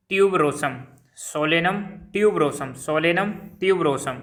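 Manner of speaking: "Solanum tuberosum" repeated slowly, word by word; slow repeated dictation. Shown as a demonstration. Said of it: "मैं दो दो तीन तीन बार बोल रहा हूँ".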